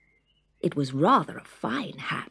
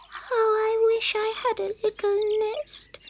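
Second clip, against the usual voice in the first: tearful. Acted.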